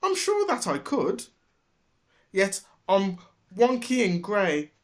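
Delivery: a small sad donkey pleading; Dilly the donkey. A narrator's reading. Dilly complains in the shed, high plaintive donkey voice.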